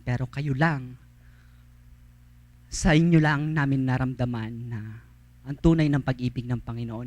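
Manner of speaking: low, soft, moved testimony; tearful gratitude. Retold or imitated. Lepers telling the missionaries why they weep, low and soft. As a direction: speak it like thanks choking back tears.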